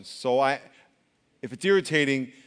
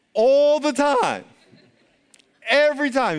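emphatic coach; 8 semitones higher, 6 dB louder, slower than usual. Retold or imitated. Excited exclaiming golf coach.

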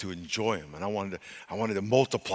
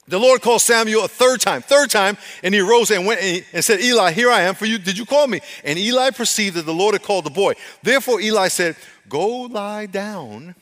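high, loud, ringing Bible reading; full-force scripture reading; 10 semitones higher, 11 dB louder than usual. Real, not acted. Preacher reading Samuel's third call aloud, full-force scripture reading. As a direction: proclaim the verses to the congregation.

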